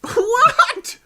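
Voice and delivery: put-on high, incredulous Jamaican-accented yelp; mock shock. Guest challenged on his accent, hammed-up shock. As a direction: act outraged disbelief in a fake Jamaican accent. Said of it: "this is not your real accent".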